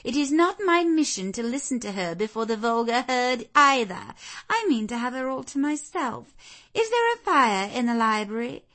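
put-on high, loud imperious young lady; haughty Blanche, commanding. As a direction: announce it as an unquestionable command.

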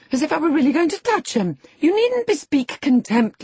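loud, high, angry scolding; in a passion. A narrator's reading. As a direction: berate him with mounting scorn. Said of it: "cried Catherine in a passion".

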